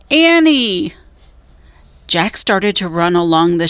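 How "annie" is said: high, loud shout of her name. A narrator's reading. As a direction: yell for her, worried. Jack shouting back.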